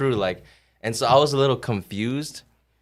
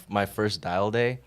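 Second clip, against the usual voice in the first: usual speaking voice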